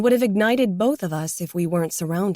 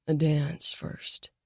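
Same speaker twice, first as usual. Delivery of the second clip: slow, soft, lower man's murmur; 3 semitones lower, 5 dB quieter, slower than usual. A narrator's reading. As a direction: murmur it low and unhurried. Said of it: "he murmured".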